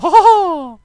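high, loud, evil cartoon cackle; hammed-up evil laugh; villain laugh. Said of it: "It's like".

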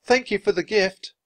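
high-pitched call of thanks; shouted thanks. A narrator's reading. Annie thanks friends in the lifeboat, calling out. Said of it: "she shouted".

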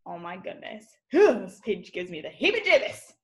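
ordinary talk

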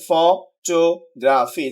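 "Photography" broken into ফ, টো, গ্রা, ফি; four separate syllables. Shown as a demonstration. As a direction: count out each syllable of "photography". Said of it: "চারটা syllable রয়েছে". ("Photography" ভেঙে ভেঙে)

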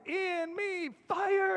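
put-on high, hysterical little-girl voice; mock-crying child's cry; wailing child. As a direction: wail it high, like a panicked child. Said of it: "starts screaming ... wailing, crying".

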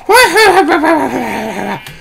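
forced high cackle, obviously put on; fake laugh. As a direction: ham up a laugh that isn't real. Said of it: "that's not a fucking real laugh".

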